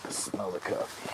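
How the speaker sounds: breathy whispered aside. Real, not acted. Noticing coffee brewing, in a whisper. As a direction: whisper it, savouring the smell.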